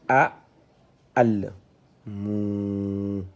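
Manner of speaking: steady model reading, each syllable on its own; detached reading. Shown as a demonstration. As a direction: separate syllables with pauses between. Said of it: "on est en détaché".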